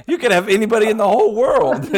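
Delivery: laughing. Retold or imitated. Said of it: "He's like".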